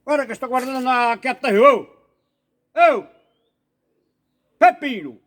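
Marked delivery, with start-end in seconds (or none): 1.5-5.2 loud sharp calls across the room; loud shout at a noisy classmate; calling out. Acted. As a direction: yell to get Peppino's attention. Calling out to disturbing pupils.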